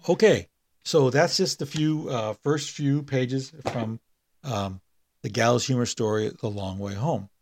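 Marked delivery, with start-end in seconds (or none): none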